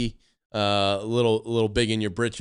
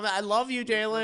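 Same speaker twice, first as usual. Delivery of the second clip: high mock voice; 10 semitones higher, 4 dB quieter than usual. Mocking the tagger.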